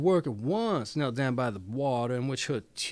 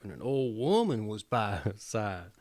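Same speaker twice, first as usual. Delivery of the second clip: ordinary delivery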